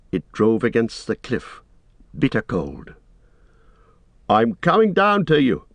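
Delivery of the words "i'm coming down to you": high-pitched call downward; raised voice, held short of full volume; Sam shouting. A narrator's reading. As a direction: shout down with urgent resolve. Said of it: "shouted Sam".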